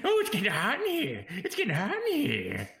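high whiny oblivious voice; whiny Adam Sandler voice. Acted. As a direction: whine it, clueless about the fire.

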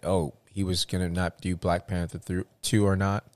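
normal talking voice

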